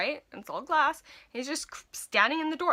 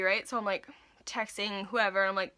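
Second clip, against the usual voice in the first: ordinary talk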